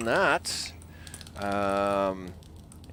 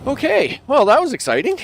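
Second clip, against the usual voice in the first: relieved laugh-talk; 11 semitones higher, 7 dB louder than usual. Real, not acted. Relief after fight.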